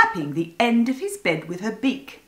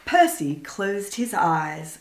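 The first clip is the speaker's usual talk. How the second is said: plain reading voice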